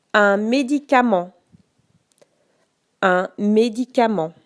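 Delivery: slow, carefully articulated dictation. Shown as a demonstration. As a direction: say each word clearly for spelling. Dictating each word twice.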